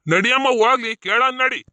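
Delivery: loud, impatient urging; loud peacemaker cutting in; insistent urging. Acted. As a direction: raise your voice to move them along. Breaking up the quarrel.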